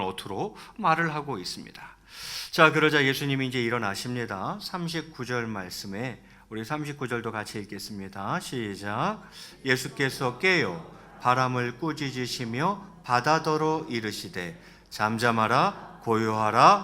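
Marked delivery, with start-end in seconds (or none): none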